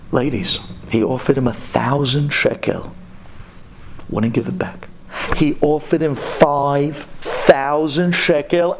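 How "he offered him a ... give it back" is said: usual speaking voice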